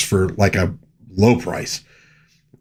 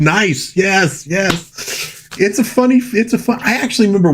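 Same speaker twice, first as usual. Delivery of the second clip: pitched much higher, a burst of delight at the find; excited delight; 9 semitones higher than usual. Real, not acted. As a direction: gush over the find. Delighted at finding The Lone Ranger.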